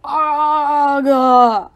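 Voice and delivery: loud, high, drawn-out "aaagh" scream. A narrator's reading. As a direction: scream as a monster grabs you. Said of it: "I screamed".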